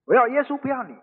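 mildly heated, falling away at the end; fed-up anger. Retold or imitated. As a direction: declare it like an exasperated wife. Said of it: "那个太太也火大了".

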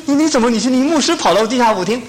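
high-pitched, stammering astonished question; shocked disbelief. Retold or imitated. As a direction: act out a scandalized churchgoer questioning her pastor. Retells sister's shocked reply to pastor.

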